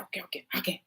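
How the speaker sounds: breathy whisper, no voice; whispered okays. Shown as a demonstration. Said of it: "I said".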